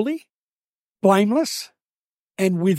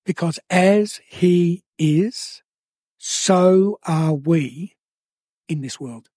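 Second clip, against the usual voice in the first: slow, drawn-out repeat; slow preacher's emphasis; slowly again; much slower than usual. Shown as a demonstration. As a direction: stretch every word out. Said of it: "I'll say it again".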